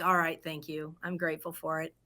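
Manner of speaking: soft, quiet resolved thanks; a softly accepting thank you; grateful. Retold or imitated. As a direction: settle into calm gratitude. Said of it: "I'm like".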